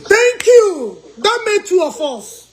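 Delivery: high, loud laughing thank you. Real, not acted. Host amused by pastor's claims, high laughing thanks. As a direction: squeal thanks through laughter.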